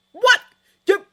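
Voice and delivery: high, loud jolted cry of surprise; startled shout. Acted. Roommate shouting awake in the dark dorm, startled loud cry. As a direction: yelp it out, wide awake. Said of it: "his shouting".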